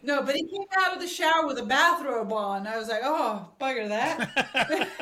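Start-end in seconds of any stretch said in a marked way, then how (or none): 3.0-5.0 mock disappointed laugh. Reaction to seeing the bathrobe.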